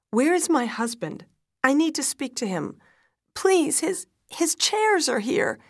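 loud, high, frantic pleading; panicked. A narrator's reading. Wife demanding her husband from the doctor, panicked. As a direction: plead with mounting panic, pitch climbing.

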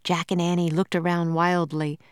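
usual speaking voice

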